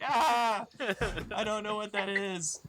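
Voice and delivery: high, puzzled cat voice. Retold or imitated. The cat answers its owner, high and puzzled. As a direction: play the baffled cat, high. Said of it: "they're like".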